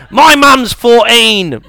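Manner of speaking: loud, slow, badly faked British accent; British regional accent. Comic mocks British social life, loud put-on British accent. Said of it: "I can't do the accent".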